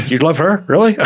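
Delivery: mock surprise with a laugh after; incredulous. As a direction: act shocked at his taste. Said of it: "I don't wanna say".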